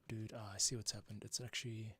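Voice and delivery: ordinary delivery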